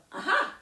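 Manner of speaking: high, loud, sudden "aha"; heroic "aha". Shown as a demonstration. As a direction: announce the handsome prince triumphantly. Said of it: "when the handsome prince goes".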